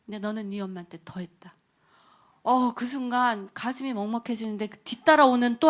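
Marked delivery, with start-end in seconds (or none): 0.2-1.5 hushed, lower, breathy voice from above; divine whisper. Retold or imitated. God answering the praying mother in a low whisper. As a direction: let it fall like a quiet verdict. God's words recalled again.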